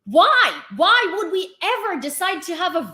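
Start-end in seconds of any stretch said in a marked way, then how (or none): none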